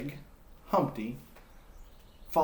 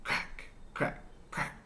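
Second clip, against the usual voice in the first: whispered crack crack crack; soft eggshell cracking sound; 8 dB quieter, slower than usual. Acted. Humpty hatching for the listening children, whispered cracks.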